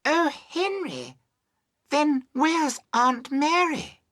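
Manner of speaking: high, drawn-out wail of dismay; wild cry. A narrator's reading. Young woman to Henry about Aunt Mary, crying wildly. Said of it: "the young woman cried wildly".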